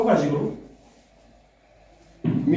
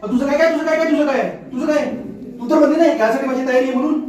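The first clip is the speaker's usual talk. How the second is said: exasperated retort; 9 semitones higher than usual. Acted. Husband exasperated by her doubts.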